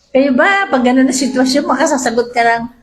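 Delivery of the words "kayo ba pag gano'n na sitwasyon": ordinary delivery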